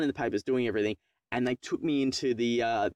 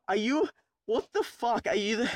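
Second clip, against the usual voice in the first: incredulous; 10 semitones higher than usual. Retold or imitated. Learner to desk worker after being told he passed, incredulous.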